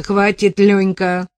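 big man yells at the wailing prophet; yelling at him. Acted. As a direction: bark at him to shut up. Big man yells at sobbing Lyonka.

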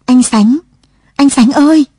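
high, loud desperate call; crying out. A narrator's reading. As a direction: call out to someone who doesn't know you. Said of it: "Lụa hét lên".

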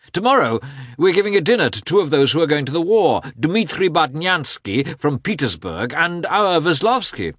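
high-pitched, gushing gentleman; exuberant Oblonsky. Acted. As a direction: bubble over with joyous encouragement. Voices Oblonsky in dialogue.

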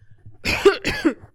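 short, loud acted cough; put-on cough. A narrator's reading. Marcine with handkerchief in hand, coughing. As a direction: cough as if catching cold. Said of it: "je crois que je m'enrhume".

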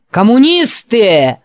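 slow, loud thundering cry; booming voice. A narrator's reading. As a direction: thunder it like a preacher. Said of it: "прогремел голос преподобного Гарднера". (громовой голос проповедника)

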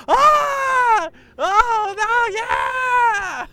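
very high, loud hammed-up squeal; mock fan shriek. Fan at Avengers Three seeing Tony Stark, high mock shriek.

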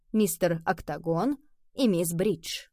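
plain reading voice

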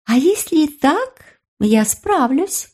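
high, slow confident fairy; a tiny creature boasting. Acted.

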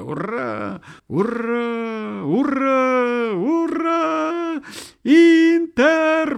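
high, drawn-out hurrahs; long high rallying shouts; rousing cheer. A narrator's reading. Rally cheering and striking up anthem.

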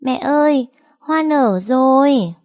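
put-on high, loud, slow child's voice; joyful little boy; happy child. A narrator's reading. Little boy telling mother the flower bloomed, happy and high. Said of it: "cười nói".

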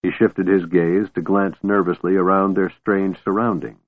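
ordinary delivery

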